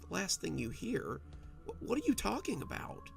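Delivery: rising panic, caught short; alarmed. A narrator's reading. Husband shocked by her words.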